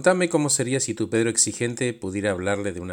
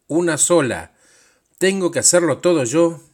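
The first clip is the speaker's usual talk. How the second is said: furious shout, higher and louder; angry scolding; 8 semitones higher, 10 dB louder than usual. Acted. Demanding inner boss yells at Pedro in fury. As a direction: berate him like a tyrant boss.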